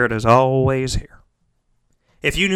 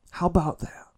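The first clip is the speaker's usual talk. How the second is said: normal talking voice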